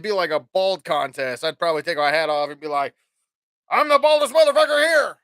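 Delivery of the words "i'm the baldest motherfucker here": high, loud, cocky shout; loud brag. Pretend. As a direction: boast it to the whole studio. Bragging on a TV show.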